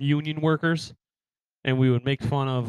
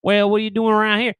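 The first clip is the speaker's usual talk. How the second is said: louder, higher mock Southern question; Southern accent; 8 semitones higher, 6 dB louder than usual. Texan confronting the visitor, mock Southern accent. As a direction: challenge them in a Southern accent. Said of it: "they got that southern accent".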